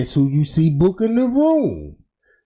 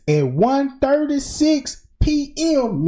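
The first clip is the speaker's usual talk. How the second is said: ordinary talk, nothing marked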